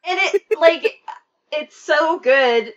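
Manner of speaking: put-on haunting child voice; creepy baby voice. Shown as a demonstration. S1 showing off the baby voice, high and eerie. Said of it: "I'm sure people are thinking".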